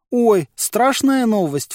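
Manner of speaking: slow, hammed-up wail of fake fear; mock horror. A narrator's reading. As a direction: wail in pretend terror. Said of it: "в притворном ужасе возопил Толстый".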